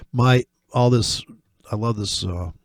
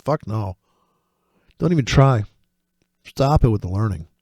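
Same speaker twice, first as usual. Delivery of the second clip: ordinary talk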